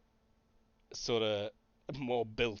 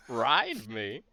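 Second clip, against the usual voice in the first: bumper car voice; 4 semitones higher, 10 dB louder than usual. Acted. Living bumper car talks.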